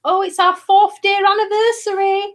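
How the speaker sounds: high, sing-song mockery of a clingy partner; gushy mock girlfriend. Mocking a partner who celebrates tiny anniversaries, high gushy mock voice. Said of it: "I'm not one like".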